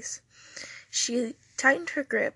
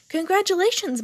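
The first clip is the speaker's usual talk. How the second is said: high, loud and beaming; excited, big-smile exclamation; delighted congratulation; 8 semitones higher, 6 dB louder than usual. A narrator's reading. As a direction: burst in with great cheer. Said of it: "came barging in with a huge smile".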